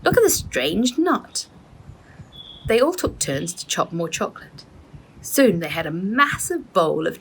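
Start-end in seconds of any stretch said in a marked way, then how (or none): 0.0-1.5 high excited call, slowing at the end. A narrator's reading. Rose calling the cousins over to the nut. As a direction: call the others over to see. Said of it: "Rose called to the others".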